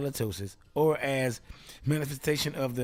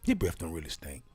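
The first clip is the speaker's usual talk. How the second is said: low-voiced gossip; 7 semitones lower than usual. Low voice gossiping about Will.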